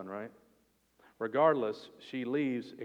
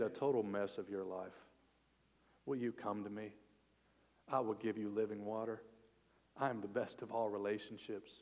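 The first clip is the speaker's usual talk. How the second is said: softer, gentle pleading invitation; tender invitation; 4 semitones lower, 7 dB quieter than usual. Retold or imitated. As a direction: invite her gently, almost hushed. Jesus's invitation voiced softly.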